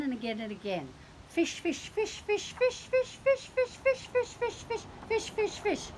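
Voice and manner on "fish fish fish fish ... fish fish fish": high cat voice chanting fish over and over; nagging cat. Acted. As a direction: pester them like a hungry cat.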